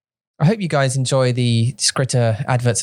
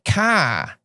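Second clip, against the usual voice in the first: "Car." said with a higher Boston "ah" and no r; Boston accent; 6 semitones higher than usual. Shown as a demonstration. Teasing his wife with a Boston "Car.". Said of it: "But in Boston, say".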